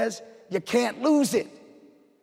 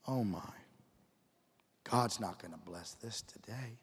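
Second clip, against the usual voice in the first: defeated inner thought; 7 semitones lower, 12 dB quieter than usual. Retold or imitated. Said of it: "and you think".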